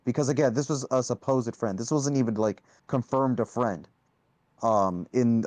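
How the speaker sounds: ordinary talk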